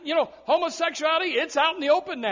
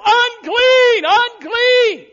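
much higher, much louder mock yell; yelled warning; 11 semitones higher, 11 dB louder, much slower than usual. Brother Kelvin spotting the leper, loud mock yell. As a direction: holler the alarm across the room. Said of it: "Brother Kelvin would have yelled".

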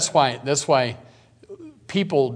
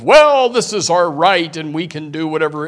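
raised, loud mocking quote of the crowd; mocking rant; 5 semitones higher, 8 dB louder than usual. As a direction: ham up their defiant ranting, mildly. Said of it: "We hear all the rantings and ravings of".

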